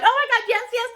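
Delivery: squealing high with excitement; excited fans. Retold or imitated. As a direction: gush like a thrilled audience. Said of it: "They're like".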